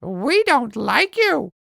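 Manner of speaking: high, slow parrot echo; parrot copying. Acted. Parrot copying Ella to tease her, high parrot voice. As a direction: parrot mimicking the girl word for word. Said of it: "Parrot repeats".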